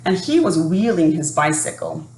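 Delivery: put-on lively storybook narration for young listeners; children's story reading. A narrator's reading. Teacher reading a story to children, lively storybook voice. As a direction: read it like a picture book at story time.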